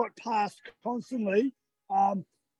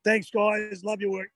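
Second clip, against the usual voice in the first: usual speaking voice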